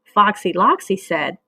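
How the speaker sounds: usual speaking voice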